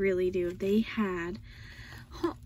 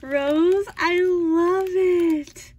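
loud, slow, gushing thanks; shouted heartfelt thanks, drawn out; 4 semitones higher, 11 dB louder, much slower than usual. Real, not acted. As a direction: call it out with all your heart. Shouting thanks to gift giver.

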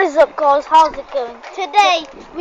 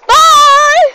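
higher, louder goodbye; shouted bye; 10 semitones higher, 9 dB louder than usual. Real, not acted. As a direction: call out the farewell. Signing off the video.

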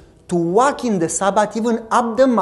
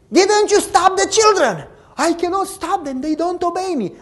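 high, loud mock scolding; acted scolding father; 11 semitones higher, 5 dB louder than usual. Preacher acting out the church caretaker scolding his son. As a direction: play the angry father and the whining boy.

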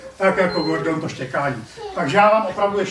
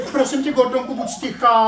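acted pleading; 8 semitones higher, 3 dB louder than usual. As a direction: beg the barking dog to stop. Postava prosí štěkajícího psa o ticho. (úpěnlivá prosba)

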